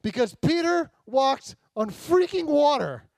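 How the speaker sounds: freaking punched, slow and loud; stress on freaking. Shown as a demonstration. Preacher hammering the miracle home, freaking stressed loud and slow. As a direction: lean on freaking, slowly. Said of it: "put a freaking in there too".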